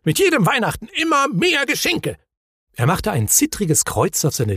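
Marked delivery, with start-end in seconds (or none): 0.0-2.2 very high-pitched exclamation; exasperated cry. A narrator's reading. Hemul overwhelmed by presents, high exasperated cry. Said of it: "rief der Hemul aus".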